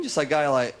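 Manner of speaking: normal talking voice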